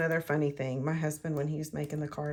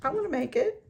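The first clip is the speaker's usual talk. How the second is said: put-on a much higher, boyish eager voice; eager husband; 11 semitones higher than usual. Husband begging to make the card, high and eager. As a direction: chirp it like an excited kid. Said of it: "he was like".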